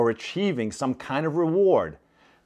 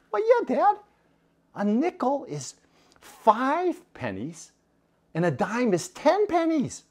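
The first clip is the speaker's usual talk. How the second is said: put-on little girl voice; 12 semitones higher than usual. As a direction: explain it like a proud four-year-old. Child explains coins.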